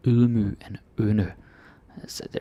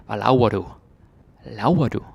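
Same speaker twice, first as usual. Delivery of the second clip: lightly mocking Jutlandic accent, laver as "lauwer"; 5 dB louder than usual. Teacher jokingly imitates Jutlanders, a mock Jutlandic accent. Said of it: "I'm gonna make fun of them".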